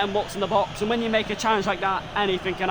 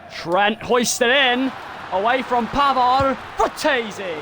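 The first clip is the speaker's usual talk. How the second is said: play-by-play commentary; 7 semitones higher, 5 dB louder, slower than usual. Acted. Mock commentary on set piece.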